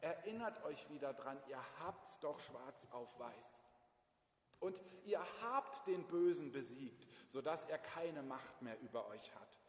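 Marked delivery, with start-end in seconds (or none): none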